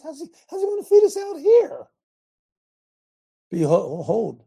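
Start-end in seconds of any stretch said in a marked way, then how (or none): 0.0-1.9 high, loud whiny complaint; mocking Israelites. Preacher mimicking grumbling Israelites, high and whiny. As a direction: whine it like a doubter. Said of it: "They said".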